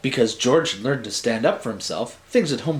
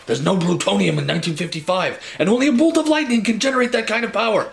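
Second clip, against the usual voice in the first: fast, higher Doc with a hint of Brooklyn accent; Brooklyn-ish Doc; 8 semitones higher, faster than usual. Doc giving Marty bad news in a fast, lightly Brooklyn accent. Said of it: "I don't know why he's from Brooklyn".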